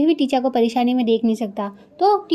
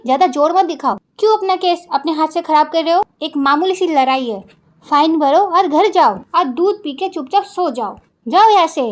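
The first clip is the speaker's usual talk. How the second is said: loud, firm telling-off that rises to a shout; stern scolding; 6 dB louder than usual. Acted. Lawyer scolding a brawling young man, loud and stern. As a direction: scold him hard and send him away. Teacher breaks up a fight.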